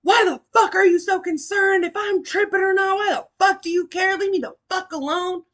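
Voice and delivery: angry, high, loud imitation of his yelling. Boyfriend or friend yelling at someone tripping on shrooms, angry high yell. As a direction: yell it accusingly, mimicking his outburst. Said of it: "He starts yelling at me".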